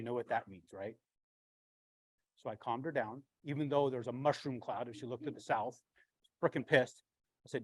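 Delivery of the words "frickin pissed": pissed off. Real, not acted. Angry about failed test.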